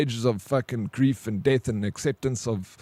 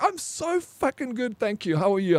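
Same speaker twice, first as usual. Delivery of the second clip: overjoyed doctor; 9 semitones higher than usual. Retold or imitated. Doctor answering the worried patient's greeting, mock overjoyed. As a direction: imitate a doctor bursting with good news. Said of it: "You sound so happy".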